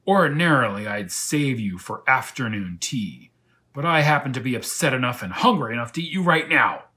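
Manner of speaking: loud, threatening lion voice; hungry lion. Acted. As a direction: relish the threat loudly. Lion threatening to eat him.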